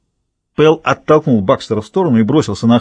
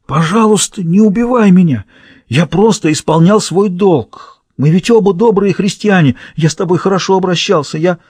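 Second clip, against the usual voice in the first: high, desperate begging; terrified pleading; 7 semitones higher than usual. A narrator's reading. Wounded guard begs approaching prisoner for his life, terrified pleading. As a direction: plead for your life, pitch raised throughout. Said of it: "Глаза надзирателя расширились от ужаса".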